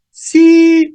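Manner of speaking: sí begins very high and loud; high start sí. Shown as a demonstration. Teacher showing the high start of sí. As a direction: launch sí from way up high.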